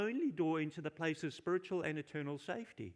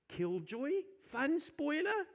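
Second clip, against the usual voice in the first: mocking sheep; 11 semitones higher, slower than usual. Mock sheep insult to shepherd.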